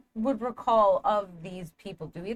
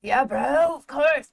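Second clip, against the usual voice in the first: tiny lizard voice; 4 semitones higher, 7 dB louder, much slower than usual. Acted. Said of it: "tiny little lizard voice".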